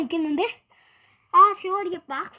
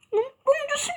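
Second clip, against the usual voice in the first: mock crying; 9 semitones higher than usual. Mock crying warning in game.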